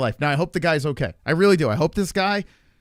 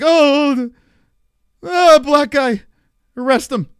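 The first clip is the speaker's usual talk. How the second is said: higher, much louder, drawn-out mock whine; mocking whiny chat voice; 8 semitones higher, 9 dB louder, much slower than usual. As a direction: ham up a whiny chatter crying for the police. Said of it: "We could be a little bitch in the chat and be like".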